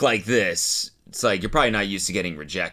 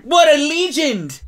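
mock cheer; 7 dB louder, slower than usual. Cheering the lads.

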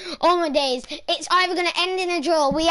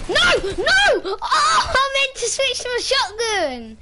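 frustrated shout; 9 semitones higher, 4 dB louder, slower than usual. Real, not acted. Misclick in game, crying shout.

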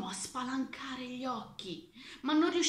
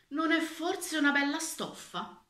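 higher-pitched leading question; coaxing impostors; 7 semitones higher, 3 dB louder than usual. A narrator's reading. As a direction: sell it with a raised, inviting lilt. Impostors coax the official.

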